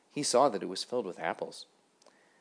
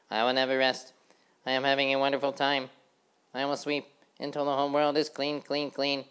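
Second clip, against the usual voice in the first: high busy housefly chanting; sing-song of a tidy little fly; 6 semitones higher, 5 dB louder than usual. A narrator's reading. Housefly sweeping the road, chanting brightly and high.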